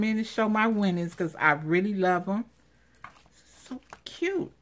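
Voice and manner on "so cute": high squeak. Real, not acted. Gushing over gift.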